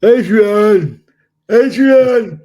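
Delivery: loud, high Stallone-style bellow of Adrian; Rocky voice. Pretend. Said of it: "But in the Rocky voice?".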